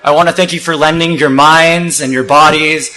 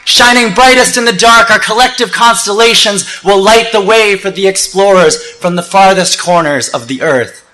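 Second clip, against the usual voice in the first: raised, ringing rally oratory easing at the end; rousing spoken-word; 6 semitones higher, slower than usual. Acted. Speaker rallying thousands to stand together, rousing oratory. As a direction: project to the crowd, then settle on the last lines.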